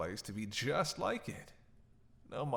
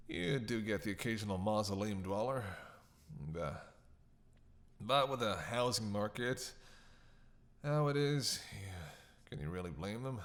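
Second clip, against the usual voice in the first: ordinary delivery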